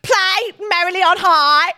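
imitating a drunk Birmingham friend; Brummy drunk mate. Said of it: "Brummy accent".

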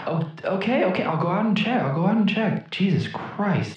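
high, soft grumbling character; put-upon villager. Acted. Villager agrees to go out and check, high soft grumble. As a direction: give in reluctantly, high and hushed.

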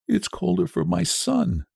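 high, grieving reply; tearful. A narrator's reading. Old woman mourns her son to her husband, tearfully. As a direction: answer through a mother's grief. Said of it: "and wept afresh".

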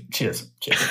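quick British cheers under the breath; muttered British accent. Retold or imitated. American muttering British cheers at the door. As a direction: mumble British cheers to blend in. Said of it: "under my breath say it with a British accent".

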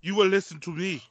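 commanding African accent; put-on African accent, playfully menacing; angry African. Shown as a demonstration. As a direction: take charge in a mock-angry African accent. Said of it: "if you need angry African".

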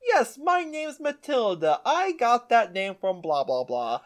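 hammed-up, unconvincing Cockney; fake Cockney accent. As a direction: put on a lightly fake Cockney voice. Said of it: "in a very fake, like, um, Cockney accent".

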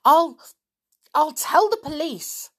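very loud, high, stammering threat; girl threatening a bully, shouting; furious shout. Acted. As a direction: sputter the threat in rage. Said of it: "yelled the little girl in a fury".

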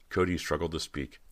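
ordinary delivery, nothing marked

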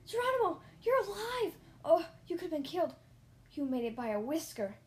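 loud, high, frantic cries; squealed outburst from worried family; shouting. A narrator's reading. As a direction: shriek with alarmed relief. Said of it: "Everyone was shouting".

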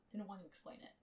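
usual speaking voice

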